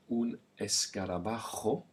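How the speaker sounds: un escarabajo with raspy kho, escarabakho; teacher models the jo in escarabajo; breathy ch sound. Shown as a demonstration. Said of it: "And then we have the jo".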